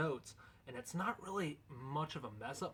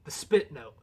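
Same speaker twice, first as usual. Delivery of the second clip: louder, punching the word spit; energetic restart; 10 dB louder than usual. Real, not acted. Restarting the lesson for the viewers, louder with spit stressed. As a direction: hit spit hard to kick off the take. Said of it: "one shot to do this".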